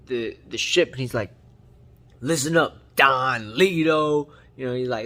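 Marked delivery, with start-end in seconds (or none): 2.2-4.3 loud, drawn-out challenge; hammed-up bold shout, stretching the name; mock tough act. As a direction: put on a tough guy calling out the boss. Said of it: "putting on his tough acts".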